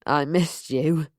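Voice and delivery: low, slow, slurred words; drunken slur. A narrator's reading. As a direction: let the words smear like too much vodka. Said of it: "he slurred".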